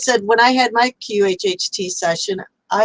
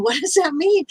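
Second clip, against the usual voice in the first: puzzled; 9 semitones higher than usual. Retold or imitated. Puzzled reply to guide.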